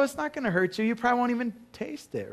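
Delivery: high, sing-song teasing mother; mock-sweet mom. Mom turning her son's excuse back on him, high and teasing.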